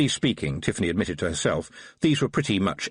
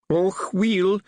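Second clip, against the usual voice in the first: higher-pitched Scottish little fighting man; Wee Mad Arthur; 10 semitones higher than usual. A narrator's reading. Small Feagle policeman to Tiffany, higher Scottish brogue.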